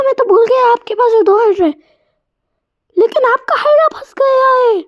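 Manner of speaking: put-on high kid voice, crying and shouting; a little boy wailing over his game; whiny child voice. (ऊँची, रोती-चिल्लाती बच्चे की आवाज़)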